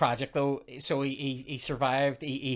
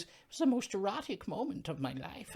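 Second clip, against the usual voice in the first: high put-on voice of an elderly woman reminiscing; Rose impression; 8 semitones higher, 4 dB quieter than usual. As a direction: lift it high, mock-dreamy. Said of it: "She goes".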